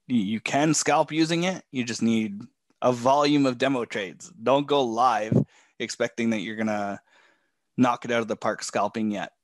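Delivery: normal talking voice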